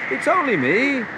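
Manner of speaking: high, reassuring call; lifted voice hailing friends. A narrator's reading. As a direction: call across the waves. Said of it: "called Jesus".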